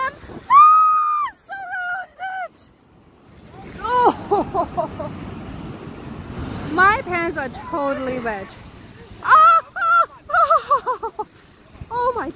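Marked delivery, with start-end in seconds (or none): none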